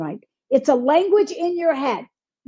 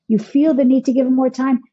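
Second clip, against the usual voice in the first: normal talking voice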